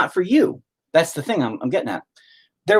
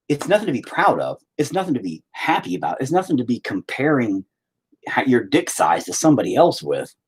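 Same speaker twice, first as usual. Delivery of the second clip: usual speaking voice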